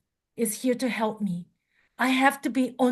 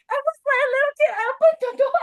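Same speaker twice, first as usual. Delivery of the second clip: high and fast, still riding the thrill; giddy excitement; 13 semitones higher, 3 dB louder, much faster than usual. Real, not acted. Retelling the joyful moment.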